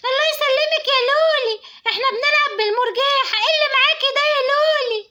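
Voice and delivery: put-on high, eager child's voice, a touch quick; little girl voice. Little girl greeting her friend Loly on the swing, high and eager.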